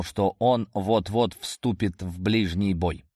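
ordinary delivery

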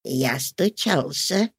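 put-on unhurried old man reply. A narrator's reading. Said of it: "ответил он".

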